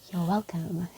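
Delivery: low unvoiced whisper; cheeky breathy whisper. Real, not acted.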